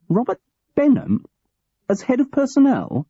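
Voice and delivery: outraged disbelief at the name; shocked disbelief. Acted. Graham shocked at rival.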